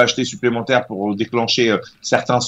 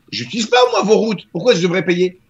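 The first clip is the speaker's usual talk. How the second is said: indignant; 7 semitones higher than usual. Retold or imitated. Voicing an indignant taxpayer.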